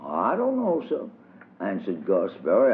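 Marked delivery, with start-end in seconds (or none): 0.0-1.0 put-on higher childish voice, innocent; child's voice, much higher; little boy. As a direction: pipe up like a small boy dodging the question. Child answers the teacher.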